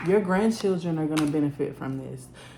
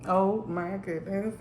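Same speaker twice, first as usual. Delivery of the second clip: normal talking voice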